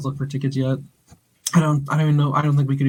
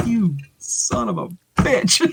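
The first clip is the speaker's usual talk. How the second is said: slow drawn-out curse; 4 semitones higher, slower than usual. Retold or imitated. Retold reaction to boss.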